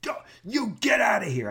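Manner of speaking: hammed-up hostile attorney yelling; mock hostile yell. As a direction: throw them out like a raging lawyer. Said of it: "He's like".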